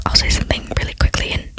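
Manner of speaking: ordinary talk, nothing marked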